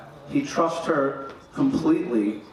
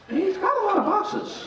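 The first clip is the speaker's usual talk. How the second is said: pitched way up in mock alarm; mock alarmed high voice; high comic thought. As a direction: squeak it out in disbelief.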